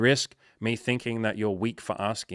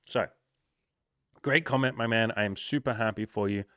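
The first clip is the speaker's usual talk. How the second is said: ordinary talk, nothing marked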